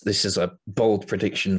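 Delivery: ordinary delivery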